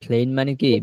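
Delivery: ordinary talk, nothing marked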